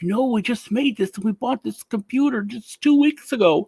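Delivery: high-pitched imitation of a tearful woman; mock near tears. Old woman to Microsoft support, imitated as near tears. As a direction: plead up high, almost crying, put on. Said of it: "like, started crying".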